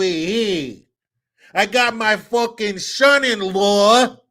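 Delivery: hammed-up Italian-American mafia father-in-law, slowed and shouted; Godfather mobster. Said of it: "some character from The Godfather's".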